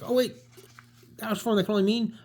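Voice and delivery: high, fast realisation; sudden realisation. Acted. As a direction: catch on suddenly, pitched high and quick. Spider-Man realising.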